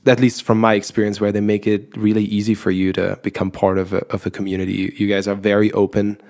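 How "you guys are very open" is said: ordinary talk, nothing marked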